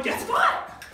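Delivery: very high breathy guess what; teasing squeal. Retold or imitated. Girl running up to her friend, teasing with excitement. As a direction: tease the news in a squeal. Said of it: "I'm like".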